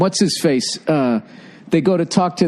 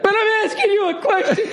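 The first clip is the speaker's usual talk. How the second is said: sudden leap into a high wail; mock crying. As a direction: imitate him bursting into tears mid-argument. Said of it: "he fucking starts crying".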